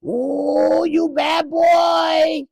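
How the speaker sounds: very high, loud mock-outraged shriek; high shrieking accented voice. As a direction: shriek it like a caricature shopkeeper. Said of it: "here come".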